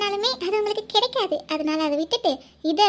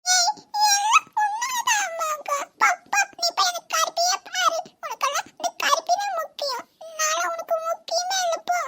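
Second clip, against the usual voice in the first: put-on high, tearful childish blame; a jealous little girl accusing her mother; whiny sulking child; 9 semitones higher than usual. Niece sulks at her mother over the goat.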